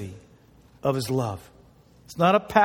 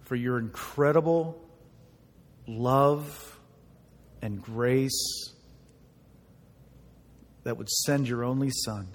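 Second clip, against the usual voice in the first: hushed prayer; 5 semitones lower, 4 dB quieter than usual. Acted. Pastor praying before the sermon, soft and hushed. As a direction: pray quietly and reverently.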